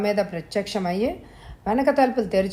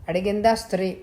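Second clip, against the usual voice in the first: ordinary delivery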